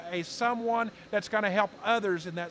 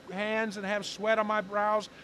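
normal talking voice

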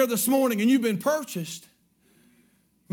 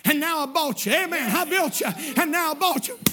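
joyful exclaim; 7 semitones higher than usual. Acted. Boy speaks to his boat.

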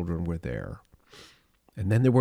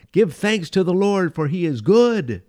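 much higher and louder, a ringing call; proclaiming praise; 9 semitones higher, 7 dB louder than usual. Acted. Closing the psalm reading, loud proclamation of praise.

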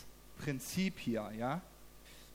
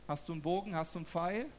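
normal talking voice

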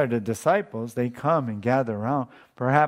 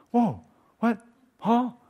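astonished; 10 semitones higher than usual. Acted. Disciples' astonished reaction acted out.

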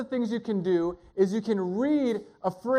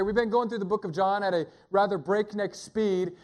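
usual speaking voice

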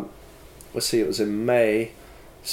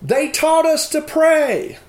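high, slow child's voice imitating a young girl; retold daughter calling out to the family; daughter's voice; 5 dB louder, slower than usual. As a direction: speak as the nine-year-old daughter, urgent. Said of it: "she says".

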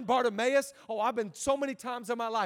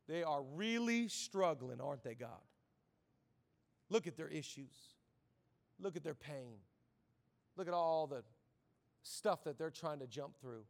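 hushed mock pity; 7 semitones lower, 9 dB quieter than usual. Self-righteous man telling God about others' struggles, hushed mock pity. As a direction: confide it quietly to God, with long pauses. Pharisee privately looking down on others.

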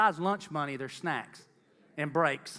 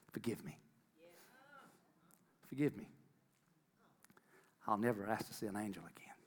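soft pleading; 6 semitones lower, 9 dB quieter than usual. Retold or imitated. Retold tearful prayer, much softer.

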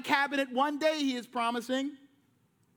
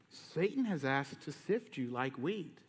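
lower, softer, slow and heavy; grave Jesus warning; 9 semitones lower, 7 dB quieter, slower than usual. Retold or imitated. Jesus warning Peter, low and slow. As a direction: warn him slowly and gravely. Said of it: "and he says".

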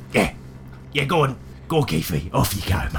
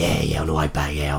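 normal talking voice

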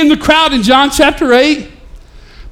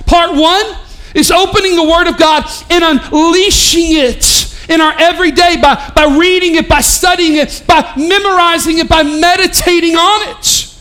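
raised, ringing preacher voice; preaching; 5 semitones higher than usual. Real, not acted. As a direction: preach it out to the whole room. Preaching full force.

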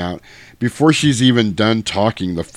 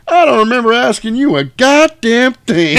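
much higher, loud furious snap; Samuel L. Jackson Vader; 8 dB louder than usual. Retold or imitated. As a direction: put the officer in his place. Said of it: "Darth Vader is Samuel L. Jackson".

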